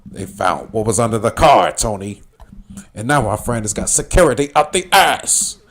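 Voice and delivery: mock menacing crime boss, building emphasis; lightly voiced angry drug lord; Sosa from Scarface. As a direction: deliver the threat as a movie villain. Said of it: "on the phone with Sosa".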